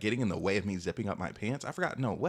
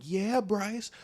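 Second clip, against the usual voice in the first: high, excited cheer from a friend; hyping up; 11 semitones higher, much slower than usual. Retold or imitated. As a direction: cheer him on, pitched way up. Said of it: "they were hyping me up".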